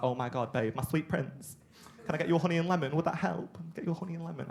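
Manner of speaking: hammed-up tender fussing; mock sweet girlfriend. Girlfriend fussing over her coughing boyfriend, mock doting. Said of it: "And she was like".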